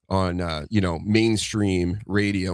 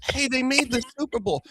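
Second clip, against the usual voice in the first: much higher, breezy carefree fan voice; mock cheerful fan; 3 dB quieter than usual. Relaxed Patriots fans after a loss, high mock cheer. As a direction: shrug it off like a spoiled fan.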